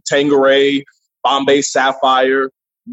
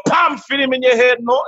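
put-on Jamaican accent; 8 semitones higher than usual. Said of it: "Jamaicans are looking at you like".

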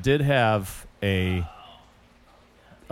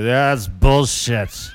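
put-on drunk heckle; 5 dB louder, much slower than usual. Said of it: "he was so drunk, he'd go".

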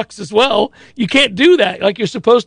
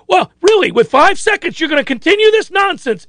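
angry coach; 10 semitones higher than usual. Retold or imitated. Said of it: "the coach got so mad".

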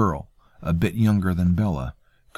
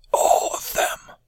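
hoarse, pained whisper; slower than usual. A narrator's reading. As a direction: force out a raw, painful whisper. Janus's wounded whisper.

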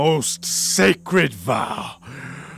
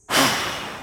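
a long, high, breathy exhale; heavy sigh; 8 semitones higher, 4 dB quieter than usual. Acted. Othello releasing his love, heavy sigh. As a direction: blow it all away.

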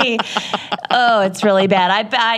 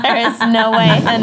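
laughing; 2 semitones higher than usual. Shown as a demonstration. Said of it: "That was so stupid".

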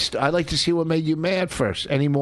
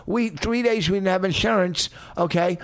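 ordinary delivery

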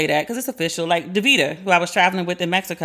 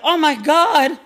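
high, loud panicked mom; mother panicking; 9 semitones higher, 5 dB louder, slower than usual. Retold or imitated. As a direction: imitate a frantic mother. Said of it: "She's like".

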